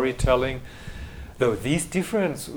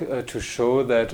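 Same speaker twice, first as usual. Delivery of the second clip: ordinary talk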